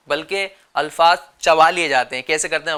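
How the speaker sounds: usual speaking voice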